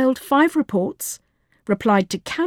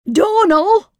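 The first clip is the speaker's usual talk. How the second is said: calling out; 11 semitones higher, 8 dB louder than usual. Acted. Neighbour calls his name.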